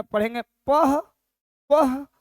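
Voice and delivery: पह said with a breathy h after प; visarga sound. Shown as a demonstration. Teacher models reading प with visarga for students. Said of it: "अब विसर्ग लगा दिए तो क्या बनेंगे".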